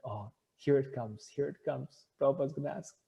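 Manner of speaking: hushed, bracing for what's coming; dreading inner thought. Retold or imitated. Devotee bracing for the guru's request, quiet dread.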